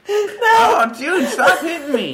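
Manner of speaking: high and loud, laughing; laughing protest. Real, not acted. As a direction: yelp and laugh while complaining. Friend hitting, laughing protest.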